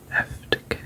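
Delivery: ordinary delivery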